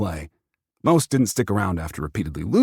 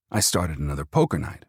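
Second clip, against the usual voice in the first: usual speaking voice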